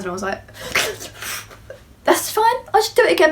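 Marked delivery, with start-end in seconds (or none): 0.6-1.4 a nervous, breathy little laugh; awkward breathy laugh. Real, not acted. At the Chanel till, card declined: awkward breathy laugh. 2.0-3.3 higher, fast, falsely casual; forced breezy reply. Retold or imitated. Telling the shop assistant her declined card is fine: fast forced breeziness.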